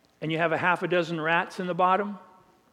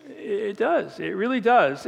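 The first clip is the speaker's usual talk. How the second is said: ordinary talk, nothing marked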